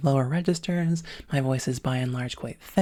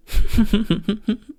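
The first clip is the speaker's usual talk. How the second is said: sudden higher, louder laugh; real laugh; 7 semitones higher, 8 dB louder than usual. Real, not acted. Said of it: "So, oh, sorry".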